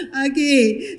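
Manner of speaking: high, giddy laugh breaking into words. Real, not acted. Mother laughs with her daughter at the wedding, giggly.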